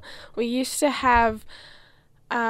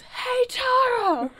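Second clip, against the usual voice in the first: high, slow called-out yell; 4 dB louder, slower than usual. Pretend. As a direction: call out across a crowd. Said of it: "Just yells".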